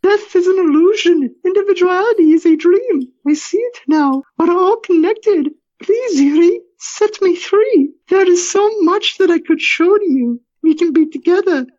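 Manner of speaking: very high, tearful falsetto; longing high wife's voice; creature speaking as wife. Acted. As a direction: plead to your husband in a high, crying voice. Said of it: "The Alina thing speaks".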